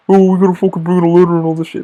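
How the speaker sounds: mock-exasperated worker's moan; mimicked complaining. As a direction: imitate a whining coworker in a put-on voice. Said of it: "you're complaining that you gotta fix".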